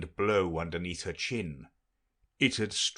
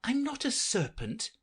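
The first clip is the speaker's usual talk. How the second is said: indignant; 14 semitones higher than usual. Acted. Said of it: "said Alice indignantly".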